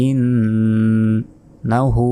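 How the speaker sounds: ইন্নাহু with a long held nasal n; ইন্নাহু with a nasal ghunna held long; nasalized ghunna. Shown as a demonstration. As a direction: hum the ন in ইন্নাহু. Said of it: "গুণা হবে".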